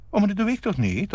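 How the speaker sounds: put-on high-pitched reasoning child; logical protest. A narrator's reading. Boy answering his scolding mother, logically. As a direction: object innocently in a high voice. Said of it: "antwoordde hij logisch".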